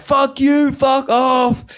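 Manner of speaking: high, drawn-out mock scream; imitated screaming. Roommate pushing out door panel, imitated screaming. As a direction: scream abuse, acting out his rage. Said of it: "and he's still screaming".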